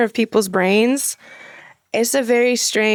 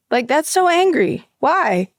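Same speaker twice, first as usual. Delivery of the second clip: ordinary delivery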